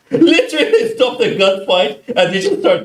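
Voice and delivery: laughing, pitch jumps up on "Literally"; laughing high exclamation. Real, not acted. Telling a friend about the dancing players, laughing high.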